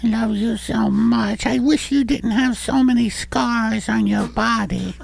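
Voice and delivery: put-on high little boy voice; kid voice. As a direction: play a sweet little boy. Child voice.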